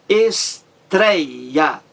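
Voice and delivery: "estreia" split into three separate beats; teacher counting out the syllables of "estreia". Shown as a demonstration. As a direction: break "estreia" up as es, trei, a. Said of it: "três sílabas".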